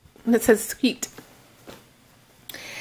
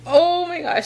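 ordinary delivery

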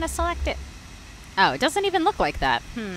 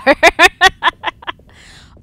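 big, high burst of laughter; loud laugh; 10 semitones higher, 17 dB louder than usual. Real, not acted. Streamer cracks up at a chat comparison, loud laugh.

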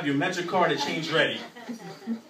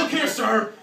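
prim, proper complaining customer, louder and higher; 6 semitones higher, 6 dB louder than usual. Retold or imitated. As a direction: put on a polite uptight voice threatening a letter.

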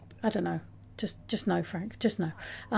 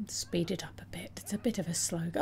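whisper; 4 semitones lower, 8 dB quieter than usual. Real, not acted. Urging the sim under her breath.

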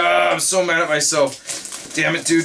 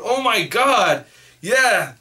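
usual speaking voice

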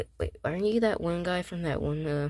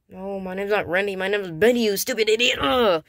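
furious correction of his name; angry yelling; 4 semitones higher, 6 dB louder than usual. Acted. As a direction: yell the insult, louder and higher. Insisting on his name, insulting.

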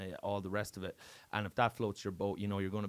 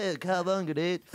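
higher, louder mock surfer dude; hammed-up Californian surfer; California surfer accent; 8 semitones higher, 6 dB louder than usual. Self-mockery of coming back from America, California surfer accent. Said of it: "sounding like a fucking California surfer".

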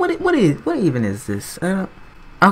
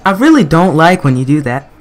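much louder, fed up with the game; louder exasperated repeat; 8 dB louder than usual. Real, not acted. Gamer yelling at a boss fight, louder frustrated repeat. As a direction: push it out loud, losing patience.

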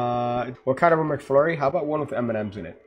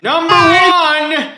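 stretched-out, much higher and louder victory yell; triumphant shout; 12 dB louder, much slower than usual. Real, not acted. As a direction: roar it out like you just won.

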